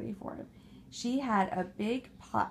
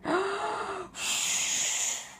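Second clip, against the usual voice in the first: frightened gasp; 9 semitones higher than usual. Acted. Pigs gasp again.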